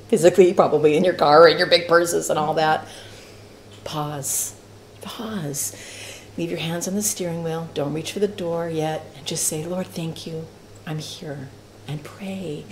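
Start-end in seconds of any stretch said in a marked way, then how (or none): 3.8-12.6 much softer, calm and hushed; slow them down to a quiet prayer; hushed guidance. Acted. Guiding the class through a quiet prayer in the car.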